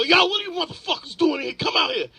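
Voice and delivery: high, loud thug shout; mock gunman yell. Shooter yelling at guys hiding in the closet, loud and high. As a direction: bark it like a gunman finding you hiding.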